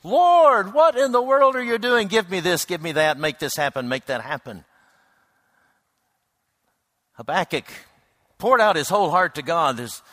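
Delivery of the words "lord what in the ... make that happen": much higher and louder, impatient demands to God; hammed-up pushy petition; demanding prayer. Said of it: "You know how we often pray".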